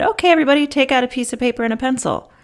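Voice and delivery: rallying the class on day one; brisk teacher instruction. Retold or imitated. Teacher addressing class first day.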